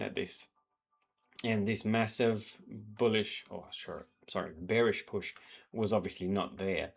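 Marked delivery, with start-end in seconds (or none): none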